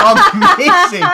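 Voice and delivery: high squeal through a laugh; laughing amazing. Real, not acted. Host cracking up at viewer's joke, laughing. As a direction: laugh it out, delighted.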